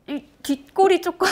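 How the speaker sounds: high, loud, breaking into laughter; laughing. Real, not acted. Speaker describing irritation at hurtful facts, laughing. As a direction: start cracking up as you talk.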